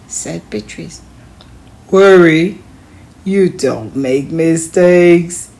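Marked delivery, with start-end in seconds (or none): 1.9-5.5 a hint of a louder, slow dad voice; lightly voiced father. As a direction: reassure her as her father. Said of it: "he said with a smile".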